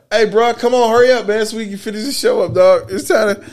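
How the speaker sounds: normal talking voice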